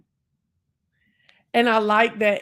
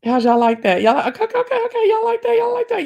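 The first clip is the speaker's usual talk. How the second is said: much higher, loud squealing okays; excited cheering; 7 dB louder, faster than usual. Real, not acted. Thanking viewers for a gift, squealing with excitement. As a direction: hype up the chat. Thrilled reaction after a gift.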